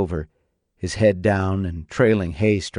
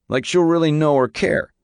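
higher-pitched bitter sarcasm; disdainful; 6 semitones higher than usual. A narrator's reading. Son doubting his mother will care, disdainful and high. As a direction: scoff at the very idea. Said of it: "said disdainfully".

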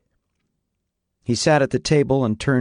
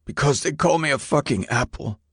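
put-on hurt teen; 5 semitones higher than usual. A narrator's reading.

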